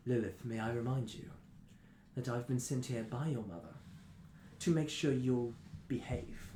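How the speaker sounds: low, soft, measured retort; lightly voiced smooth reprimand, slowing at the end; Loki. Minder sent by her mother reminding Lilith, quietly stern. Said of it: "And he says".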